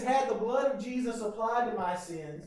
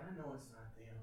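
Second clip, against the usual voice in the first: much softer, lower muttered aside; under your breath; 10 semitones lower, 20 dB quieter than usual. Retold or imitated. Mourner admitting the truth, under the breath. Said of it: "You say it under your breath".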